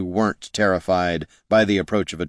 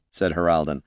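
ordinary delivery, nothing marked

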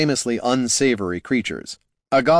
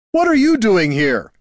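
high, loud startled holler at the dwarf; 10 semitones higher, 6 dB louder than usual. A narrator's reading. Fat man hollers at Gorm and the goblin, loud and high. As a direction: bellow it out, caught off guard. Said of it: "hollered the fat man".

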